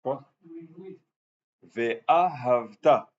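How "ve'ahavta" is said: Ve'ahavta very slow, stress on Ve'a and on the final ta; stress demo. Shown as a demonstration. Rabbi spelling out the stress of Ve'ahavta slowly. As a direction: stretch Ve'ahavta out, hitting Ve'a and ta. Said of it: "l'accent tonique sur le Ve'a et ensuite".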